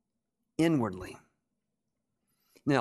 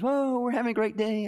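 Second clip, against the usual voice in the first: imitated sweet cheeriness; 7 semitones higher than usual. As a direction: mimic a relentlessly sweet optimist. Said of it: "she's so sweet".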